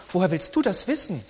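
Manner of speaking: high, miffed comeback; lightly voiced indignant girlfriend; slightly piqued. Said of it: "gibt sie leicht pikiert zurück".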